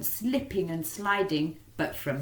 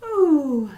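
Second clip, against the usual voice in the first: tired ooh; 10 semitones higher, 13 dB louder than usual. Acted. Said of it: "and a".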